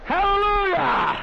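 drawn-out, high, loud hallelujah; a preacher's joyful shout; shout of praise. Real, not acted. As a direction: let it ring out to the rafters. Preacher shouting praise mid-story.

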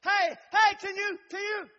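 loud, high holler at a door. Acted. Savior calling through a closed door, hollering. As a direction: call out hard to someone inside. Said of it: "maybe if I holler".

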